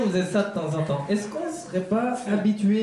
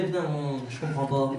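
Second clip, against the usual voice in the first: dog inner voice; 6 semitones lower, 4 dB quieter than usual. Retold or imitated. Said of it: "il se disait".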